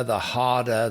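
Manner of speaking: ordinary delivery, nothing marked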